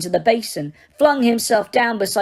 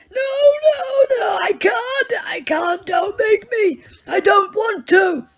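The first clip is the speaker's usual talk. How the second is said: high, crying shout of no; old man screaming and crying; screaming refusal; 12 semitones higher, slower than usual. Acted. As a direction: scream and sob in terror. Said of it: "Dumbledore screamed".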